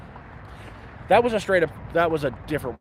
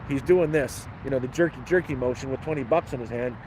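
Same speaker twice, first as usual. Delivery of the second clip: normal talking voice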